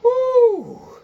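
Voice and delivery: very high loud whoop; gleeful whoop. Retold or imitated. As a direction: cheer wildly for the chorus. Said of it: "he's like".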